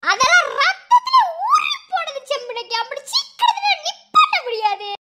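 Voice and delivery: high-pitched squealing retort; high, swooping, squeaky yell; baby-rat squeal. Acted. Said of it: "எலிக்குட்டி மாதிரி கத்துற".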